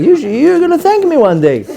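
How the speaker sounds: high, laughing retort; laughing comeback. Retold or imitated. Rabbi answering the angry teen, high laughing retort. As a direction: tease him cheerfully. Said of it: "I was like".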